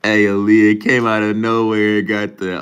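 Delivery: loud, high-pitched excited exclamation; amused outburst. Real, not acted. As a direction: blurt it out loudly, fresh from laughing. Amused outburst at sudden appearance.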